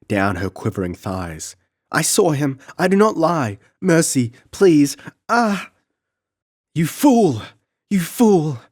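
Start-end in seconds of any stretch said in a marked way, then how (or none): 1.9-5.7 very high, loud cries of pain; whipped child begging the count; desperate pleading. Acted. Child pleading under the whip. 6.7-8.6 very high, loud scream; furious scream. Acted. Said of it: "screamed Belisa".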